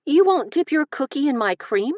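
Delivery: high, loud pout; playful mock whine. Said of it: "with a pout".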